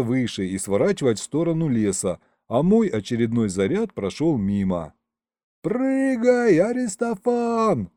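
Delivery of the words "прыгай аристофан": high, drawn-out yell; yelled order. A narrator's reading. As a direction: call up to someone far above. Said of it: "заорал я".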